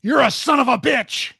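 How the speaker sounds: high, loud snapping; harsh insult. Retold or imitated. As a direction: fling the insult hard. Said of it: "he goes".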